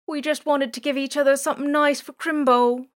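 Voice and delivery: milk the sympathy; laying it on thick. Acted. Boy to the drone, overdoing the sob story. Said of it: "laying it on a bit thick".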